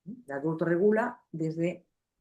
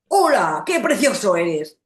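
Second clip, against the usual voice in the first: harsh greeting; 4 semitones higher, 11 dB louder, slower than usual. Shown as a demonstration. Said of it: "Si tú le dices".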